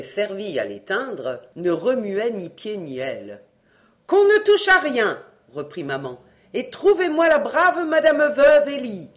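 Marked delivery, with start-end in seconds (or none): 4.1-5.2 shrill commanding shout. A narrator's reading. Mother forbids touching anything. 6.5-9.1 loud demand. A narrator's reading. Mother demands the widow.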